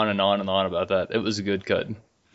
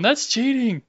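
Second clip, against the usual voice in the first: much higher, teasing protest; high mock outrage; 14 semitones higher, slower than usual. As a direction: squeak it out in fake shock.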